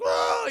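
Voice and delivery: high, mock howl of alarm; hammed-up pained whoop; freaked-out cry. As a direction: imitate a panicked cartoon yelp. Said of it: "He's fucking freaking out".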